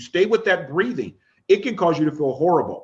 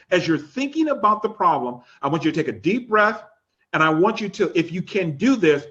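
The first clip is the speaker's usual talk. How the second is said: ordinary delivery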